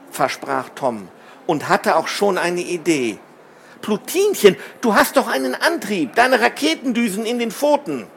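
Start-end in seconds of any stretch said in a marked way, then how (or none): 3.8-8.1 high, eager appeal; insistent, excited suggestion; urgent realization. A narrator's reading. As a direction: pitch it up with sudden hope. Urgent idea to rescue her.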